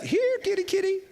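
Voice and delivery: very high falsetto cat call; comic coaxing. Pretend. Daniel calling the starved lions, comic falsetto coaxing. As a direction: squeak it like calling a pet, in jest.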